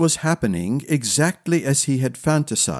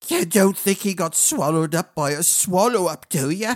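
put-on worried old woman; 4 semitones higher than usual.

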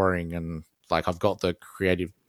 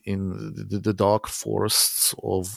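put-on Norwegian accent; 4 dB louder than usual. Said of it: "I don't have that sweet Norwegian accent".

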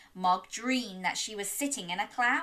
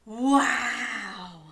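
mock amazed wow. Gasp at present.

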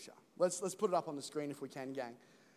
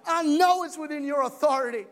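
much louder and higher proclamation; preacher proclaiming loudly to the congregation; full-force preaching; 10 semitones higher, 12 dB louder than usual. Real, not acted. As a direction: preach it out to the church.

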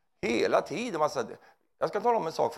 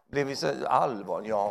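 normal talking voice

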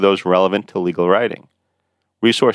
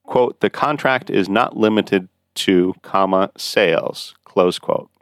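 dictating; slower than usual. Shown as a demonstration. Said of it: "Consider this example".

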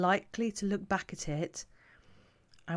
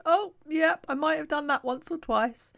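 lightly voiced, higher guilty admission; a hint of a caught-out parent; sheepish viewer; 10 semitones higher, 3 dB louder than usual. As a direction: own up with a slightly sheepish laugh in the voice. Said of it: "a few of you watching going".

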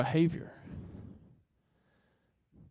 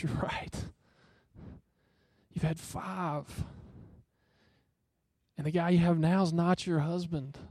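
softer tender whisper, slowing down; broken, grieving; 7 dB quieter than usual. Retold or imitated. Jesus tells the woman her truth, softly and tenderly. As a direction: breathe it out gently, full of compassion. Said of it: "He is broken for her right then".

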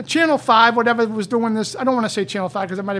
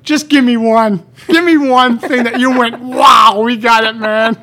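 loud challenge; 10 dB louder than usual. Retold or imitated. Loudly challenging the doctors to name one discovery. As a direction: push them hard for one win.

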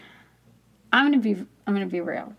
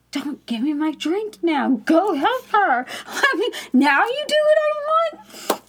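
mock-scolding, climbing higher as it goes; exasperated; 10 semitones higher than usual. Customer silently ordering the clerk to help her, exasperated.

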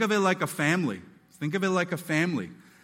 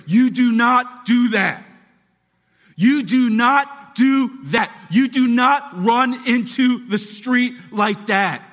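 loud, slow, every word laid down firm; very stern; 6 semitones higher, 9 dB louder, slower than usual. Pretend. Parent sternly scolding a toddler who ran toward the street. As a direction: scold the toddler so there's no doubt. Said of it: "be very stern to say".

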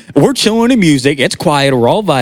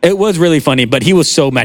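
ordinary delivery

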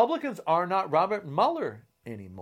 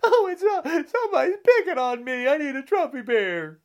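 much higher, whining mock quote; whiny crybaby Trump; 10 semitones higher, 4 dB louder than usual. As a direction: whimper like a spoiled child. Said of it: "the child that cries wolf".

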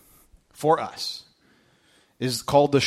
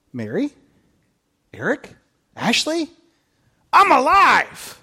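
Jesus calling out; 9 semitones higher, 4 dB louder than usual. Pretend. Pastor voicing Jesus to the congregation, joyful loud cry. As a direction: call their names, then announce it with joy.

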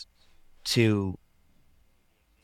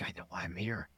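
softer, almost under his breath; quietly taking it in, much softer; hushed realization; 14 dB quieter than usual. Retold or imitated. Recalling his ayahuasca breakthrough to the host, hushed and soft.

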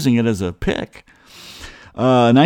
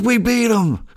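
much higher, gleeful shout; dad's excited yell; 10 semitones higher than usual. Retold or imitated. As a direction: insist on the win, shouting. Dad repeating the victory news.

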